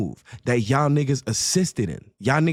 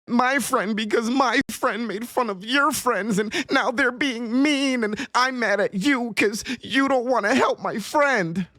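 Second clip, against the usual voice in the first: high mocking little-girl whine; mock him like a whiny little girl; 7 semitones higher than usual. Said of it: "acting like an eleven-year-old girl".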